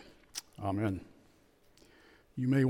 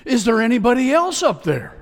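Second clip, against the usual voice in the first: loud, much higher desperate yell; 9 semitones higher, 9 dB louder than usual. Retold or imitated. Tourist refusing to let go, yelling upward. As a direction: shout upward for anyone else. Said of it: "the tourist yelled out".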